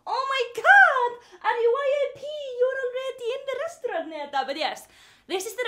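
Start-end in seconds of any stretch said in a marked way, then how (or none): 0.1-4.1 thrilled, high-pitched cooing; excited pet talk. Real, not acted. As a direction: gush at your cat like a VIP guest. Gushes at her cat.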